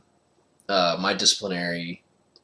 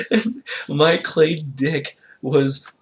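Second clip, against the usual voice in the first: laughing; 7 semitones higher, 7 dB louder than usual. Real, not acted. Laughing through the retelling.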